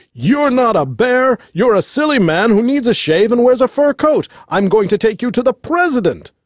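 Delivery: high-pitched, indignant tirade; yelled in rage. A narrator's reading. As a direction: rant like an enraged executive. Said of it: "yelled in rage".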